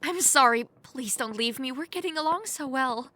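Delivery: cling to him with the apology; desperate apology. Acted. Princess begs a stranger not to leave, desperate.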